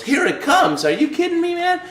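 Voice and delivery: high, loud incredulous coach; worked-up coach scolding him; imitating coach going nuts. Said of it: "And he went nuts".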